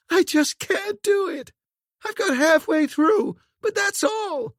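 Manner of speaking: very high, anguished wail; despairing scream. A narrator's reading. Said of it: "Corinne gave a despairing scream".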